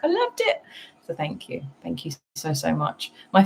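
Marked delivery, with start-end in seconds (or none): 0.0-0.6 squeaky high quote; imitating friend's excited message; quoting a friend. Vlogger thanking friend, imitating her high excited message. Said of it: "then message me like".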